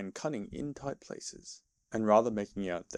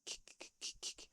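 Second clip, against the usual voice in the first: soft, unvoiced suppressed kicks; stifled kick noises; 15 dB quieter than usual. A narrator's reading. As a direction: choke the sounds down, barely audible. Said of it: "sounds resembling stifled snorts".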